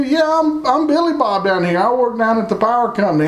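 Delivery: high, fast mock yokel reply; Billy Bob voice. Billy Bob answering the stranger, hammed-up high and fast. Acted reply in mall small talk.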